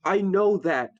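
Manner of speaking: high, loud, upset retort; exasperated outburst. Acted. Cuts off friend's lecture, upset.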